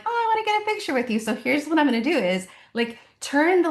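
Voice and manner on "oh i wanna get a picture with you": excited fan. Retold or imitated. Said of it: "people will be like".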